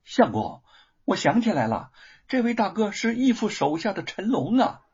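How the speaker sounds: put-on high-pitched, excited young wife; excited recognition, pitch jumping up. A narrator's reading. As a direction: blurt it out with delighted recognition. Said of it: "玉凤满脸兴奋之色".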